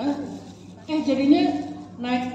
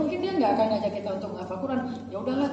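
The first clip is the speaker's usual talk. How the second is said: ordinary talk, nothing marked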